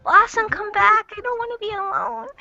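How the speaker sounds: high-pitched pretend pleading; mock plea. Host calling a lost girl back, high mock plea. Playful plea to absent friend.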